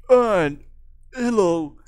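high, loud character hello; Kid Boy. Acted. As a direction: try out the high, punchy voice. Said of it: "I Kid Boy".

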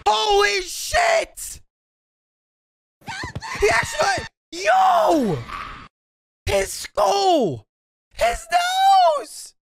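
very high, shouted disbelief; stunned outcry. Real, not acted. Viewer watching Usopp get hit, stunned high outcry. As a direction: exclaim in real shock, pitch way up.

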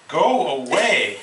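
slow, grumbling brush-off; grumpy Toad. A narrator's reading. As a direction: drag it out, wanting to be left alone. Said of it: "said the voice from a corner".

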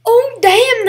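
loud, drawn-out exclamation; real amazement. Real, not acted. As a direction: burst out impressed and amused. Startled by funny game moment.

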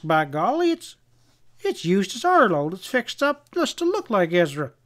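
high-pitched, astonished stammer; amazed. A narrator's reading. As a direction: blurt out the discovery in disbelief. Amazed man discovers the mask.

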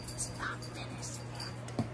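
whispered aside. Shown as a demonstration. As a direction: breathe it out in a whisper. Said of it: "repeats in a whisper".

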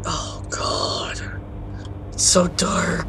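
hushed slow whisper in the dark; scared whisper creeping through a dark room. Real, not acted. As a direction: whisper so nothing hears you.

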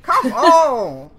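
high, loud, laughing outburst; frustrated shout. Real, not acted. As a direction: blow up at the game. Getting attacked in game.

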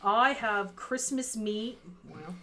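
ordinary talk, nothing marked

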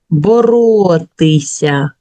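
"Боротися" slow, with the т clearly voiceless; test word sounded out. Shown as a demonstration. Teacher giving pupils the check word, slow and careful. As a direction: stretch out "Боротися" so the т rings clear. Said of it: "Чуємо ти".

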